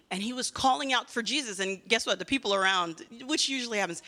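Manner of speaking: ordinary delivery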